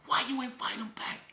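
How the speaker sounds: loud, high mounting yell; building anger. Retold or imitated. Badgering the cellmate on the top bunk, loud and high. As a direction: get madder as you repeat it. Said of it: "I'm getting madder and madder".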